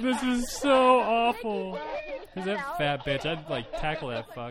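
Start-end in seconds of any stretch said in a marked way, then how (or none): 0.0-1.8 slow, high, drawn-out mocking whine; lightly overplayed misery; mock whiny complaint. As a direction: ham up the melodrama.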